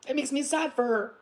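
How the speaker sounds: ordinary talk, nothing marked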